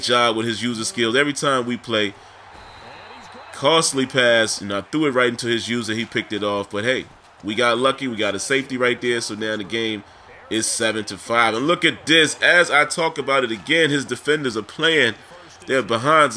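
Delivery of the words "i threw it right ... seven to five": normal talking voice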